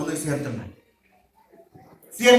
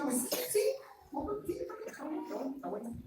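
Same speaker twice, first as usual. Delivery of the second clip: much softer, grudging mumbled agreement; reluctant yes; 6 semitones higher, 14 dB quieter, much faster than usual. Acted. Husband grudgingly agrees to buy the dress, mumbling. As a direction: say yes while clearly meaning no.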